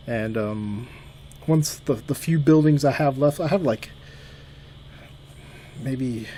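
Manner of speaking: ordinary talk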